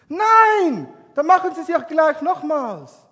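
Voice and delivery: high, loud angry no; defiant refusal. Retold or imitated. Young man refusing the pastor's advice, angry shout. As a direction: snap back a furious no.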